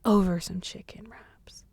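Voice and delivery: slow, hushed disbelief; soft, drawn-out mock whisper. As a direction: whisper it as if stunned.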